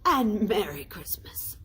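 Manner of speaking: breathy trailing off; whispered goodbye; fading farewell. A narrator's reading. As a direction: let it drift away.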